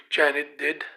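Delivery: ordinary delivery, nothing marked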